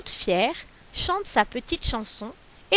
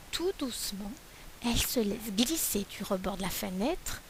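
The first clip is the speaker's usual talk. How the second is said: much softer, sneaking narration; softly; 3 semitones lower, 11 dB quieter than usual. A narrator's reading. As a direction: hush the voice as the galette slides off. Galette slips quietly away.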